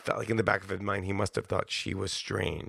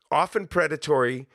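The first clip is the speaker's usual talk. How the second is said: ordinary talk, nothing marked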